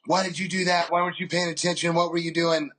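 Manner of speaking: mildly hammed-up reproach; mock angry scolding. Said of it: "just yelling at me".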